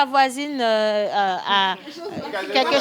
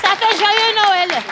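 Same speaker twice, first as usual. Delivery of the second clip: excited explanation; 6 semitones higher, 4 dB louder, much slower than usual. Real, not acted. Host explains cheer enthusiastically.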